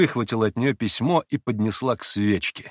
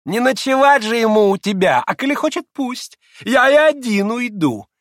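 very high, loud sarcastic jibe; mock them with biting sarcasm; sneering, high-pitched quip; caustic joking; 14 semitones higher, 8 dB louder than usual. A narrator's reading. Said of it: "язвительно подшутил Ракитин".

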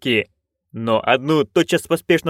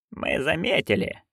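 sullen; 7 dB quieter than usual. A narrator's reading. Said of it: "угрюмо ответила матушка".

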